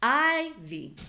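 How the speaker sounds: "ivy" with I stretched and high; high, held I in "ivy"; long stressed vowel. Shown as a demonstration. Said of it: "I is a lot longer than E".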